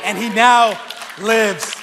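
impassioned preaching. Real, not acted. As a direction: drive each point home to the congregation. Preaches at raised force.

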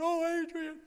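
blubbering Rocky impression; mock crying. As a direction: wail her name through fake sobs. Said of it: "He's crying".

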